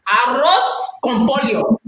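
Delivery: rolled Spanish pronunciation of "Arroz con pollo"; Spanish accent. Shown as a demonstration. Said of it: "put on my little Spanish accent".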